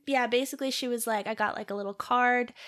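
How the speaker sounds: ordinary talk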